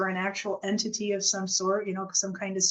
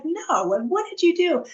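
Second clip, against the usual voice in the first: upset demand; 10 semitones higher, 4 dB louder than usual. Retold or imitated. Demanding answers from brother's ghost.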